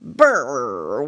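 low, trilled snail noise; warbling snail. A narrator's reading. Big snail arriving at the window, low warble. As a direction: roll out a low warbled brrr. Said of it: "warbled the snail".

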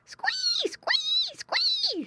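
three piercingly high squeals; piglet squeals. A narrator's reading. Hungry little pigs answer mother's call, squealing. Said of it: "squealed all the little pigs".

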